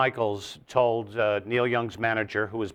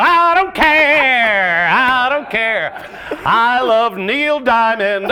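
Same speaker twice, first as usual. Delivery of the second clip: high, loud, slow, laughing dopey voice; mock crowd voice; 10 semitones higher, 9 dB louder, much slower than usual. Comic mocking a dim concertgoer, high and loud. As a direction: drawl it like a clueless fan.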